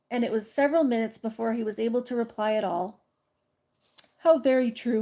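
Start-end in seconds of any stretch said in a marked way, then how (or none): none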